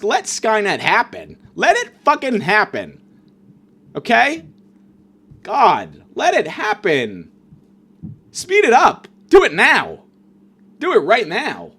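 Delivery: high, loud desperate pleading; exasperated yell. Real, not acted. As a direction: beg the end to come now, shouting. Begging Skynet to end it.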